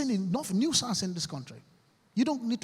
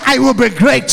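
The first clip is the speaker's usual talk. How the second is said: loud chant-like vow for the crowd to repeat; shouted affirmation; 5 semitones higher, 16 dB louder than usual. Shown as a demonstration. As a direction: lead the hall in a rallying vow.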